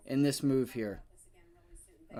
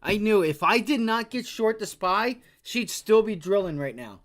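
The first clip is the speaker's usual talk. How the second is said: louder, higher frustrated rant; frustrated outburst; 7 semitones higher, 8 dB louder than usual. Real, not acted. Trader venting at his missed short, raised frustrated voice. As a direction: vent it, real exasperation.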